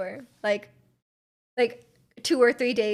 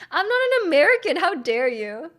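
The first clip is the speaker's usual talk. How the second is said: higher, louder, laughing fake outrage; mock offended; 5 semitones higher, 5 dB louder than usual. Streamer pretending to be insulted at being called American, mock outrage. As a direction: huff it out as if insulted, then grin.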